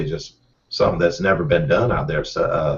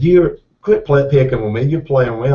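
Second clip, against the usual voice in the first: wife's playful disbelief; 6 semitones higher, 5 dB louder than usual. Retold or imitated. Wife scolding her husband for playing with her, playful disbelief. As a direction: tell him he's just messing with you.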